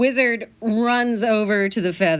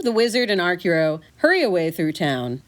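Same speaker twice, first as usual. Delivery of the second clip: ordinary talk, nothing marked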